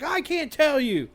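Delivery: high-pitched whine; mock distressed kid. Kid refusing his friend's dad's question, mock whine. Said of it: "he's like".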